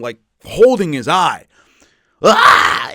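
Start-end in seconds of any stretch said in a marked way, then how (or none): 2.2-2.9 sudden very high, loud shout of pain; mock scream of a fighter clutching his eye; imitated cry of pain. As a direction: blurt out a hurt fighter's yell. Imitating Cub Swanson's pain.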